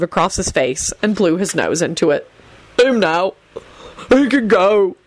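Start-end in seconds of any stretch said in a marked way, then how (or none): 2.8-5.0 understated anguish, in broken phrases; anguished bawl. A narrator's reading. Bald man orders Benji freed, anguished. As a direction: bawl it with mild grief. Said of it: "bawled out in an anguished voice".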